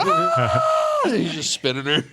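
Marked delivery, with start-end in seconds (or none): 0.0-1.0 very high, loud mock squeal; kid's scream. Child spun on the swing, high mock scream. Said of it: "She's like".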